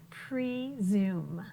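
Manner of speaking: PRESUME drawn out in two clear parts, pre-zume; word modelled for repeating. Shown as a demonstration. Teacher has class repeat presume, slow and clearly syllabled. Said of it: "Say that word with me".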